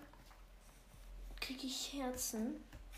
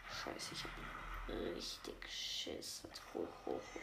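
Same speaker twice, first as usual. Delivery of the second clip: scared whisper; 6 dB quieter than usual. Real, not acted. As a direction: whisper it in fear. Admits he is scared.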